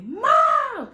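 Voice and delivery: high, loud re-enacted cry for help; scream for mom. Daughter screams for mom from the floor, reflex scream. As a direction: yell it out on pure reflex. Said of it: "for me to scream for my mom".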